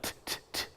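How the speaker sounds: bare unvoiced "T" taps; T sound. Shown as a demonstration. Host modelling the sound for puppet, crisp "T" taps. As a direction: tap out "T" with no vowel. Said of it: "say the T sound".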